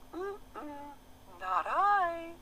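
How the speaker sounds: very high squeaky rat voice. A narrator's reading. The rat turning down the hen, high and squeaky.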